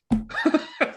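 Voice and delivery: high-pitched acted laughing fit; incredulous laugh. Healer told of the miracle, acting an incredulous laugh. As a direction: burst into mock incredulous laughter.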